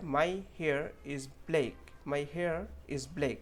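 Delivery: very slow, word by word; slow model sentence. Shown as a demonstration. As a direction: read it out slowly for learners. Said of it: "sentence मैंने लिखा है". (धीरे धीरे उदाहरण वाक्य)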